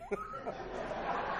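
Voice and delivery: real open laughter. Real, not acted. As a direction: laugh freely at your own joke. Laughing after joke about dad.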